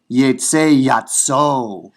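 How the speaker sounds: yetse yatso said very slowly, YETSE stressed; Hebrew demo, slow. Shown as a demonstration. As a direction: spell out the Hebrew. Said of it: "the infinitive absolute comes after".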